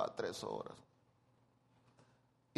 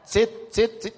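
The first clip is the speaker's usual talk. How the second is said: loud, higher commands to a dog; mock orders to a husband as if to a dog; 9 semitones higher, 8 dB louder than usual. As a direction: order him down like a pet.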